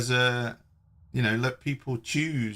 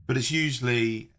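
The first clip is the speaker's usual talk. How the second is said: ordinary delivery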